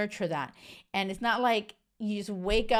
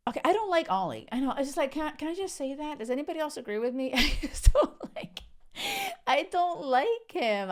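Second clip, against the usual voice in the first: higher, giggling, half embarrassed; giggly confession; 7 semitones higher, faster than usual. Real, not acted. Admitting to viewers she dislikes Ollie, giggling. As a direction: confess it with a laugh.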